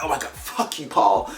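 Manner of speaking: theatrical wounded outburst, sent up; mock dramatized. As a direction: imitate an overwrought reality TV breakup. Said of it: "it always feels so dramatized".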